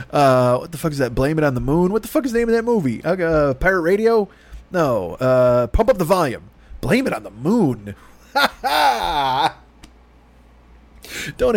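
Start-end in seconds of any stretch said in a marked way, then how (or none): none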